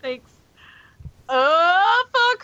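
ordinary talk, nothing marked

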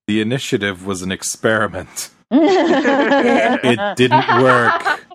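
very slow, drawn-out mock pronouncement; Joss Whedon. Host voicing the writer dismissing the storyline, slow mock verdict. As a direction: stretch each phrase out deliberately. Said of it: "straight out of Joss Whedon's mouth".

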